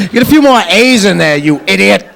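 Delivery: usual speaking voice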